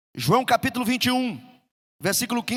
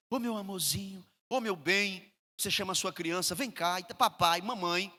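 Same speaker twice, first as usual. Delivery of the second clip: put-on much softer, tender coaxing to a toddler; baby talk to a child; 3 semitones lower, 9 dB quieter than usual. Shown as a demonstration. Father cooing softly to his small child. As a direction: kneel and coo to a little child. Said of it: "a gente muda até a voz".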